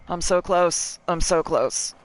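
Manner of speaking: high, strained "so close"; tense near-miss plea. Real, not acted. Nearly reaching the shard, straining.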